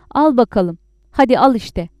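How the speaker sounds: loud, exasperated burst; defiant shout. A narrator's reading. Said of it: "diye bağırdı".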